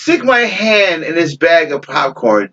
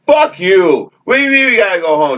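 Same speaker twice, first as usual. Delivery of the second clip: higher, fast, indignant retort; angry cussing; 7 semitones higher than usual. Retold or imitated. To his friend who says they must go home, angry retort. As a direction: snap back, fed up and cussing. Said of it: "I start cussing".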